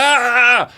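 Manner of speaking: high-pitched mock gasp of fright; mock frightened yelp. Host imitating interviewees intimidated by the mic, mock fright. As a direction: let out a high, panicky "ah". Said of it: "this intimidation factor where they're just like".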